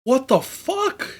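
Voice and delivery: high, slow, stunned disbelief; shocked. Real, not acted. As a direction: gasp it out in shock. Shocked by a hurt girl.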